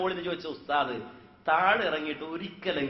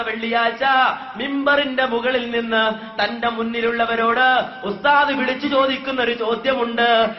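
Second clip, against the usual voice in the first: rising, tearful sermon cadence; impassioned preaching; 5 semitones higher, 8 dB louder, slower than usual. Acted. Preacher retelling the Prophet's death to the congregation, impassioned. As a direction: preach the deathbed scene with mounting feeling.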